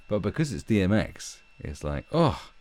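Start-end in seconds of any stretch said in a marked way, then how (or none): none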